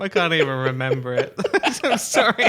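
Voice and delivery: giggling apology; sorry said between giggles; laughing. Real, not acted. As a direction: laugh through the words.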